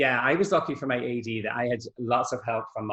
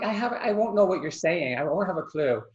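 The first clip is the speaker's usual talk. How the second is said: higher-pitched retold protest of bafflement; exasperated protest; 7 semitones higher than usual. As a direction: protest helplessly, pitched well up. Retelling her protest to Seamus.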